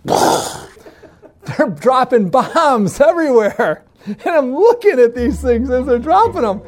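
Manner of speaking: high-pitched, stretched-out disbelief; hammed-up appalled climax; mock horror. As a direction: drag it out in comic horror. Horrified climax of cattle story.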